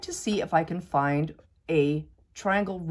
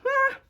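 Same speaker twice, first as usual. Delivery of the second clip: exasperated; 5 dB louder than usual. Real, not acted. Frustrated exclamation while cutting.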